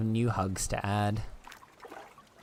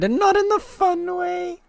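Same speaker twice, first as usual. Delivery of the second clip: whiny voice; 9 dB louder than usual. Pretend. Playful whine, far voice.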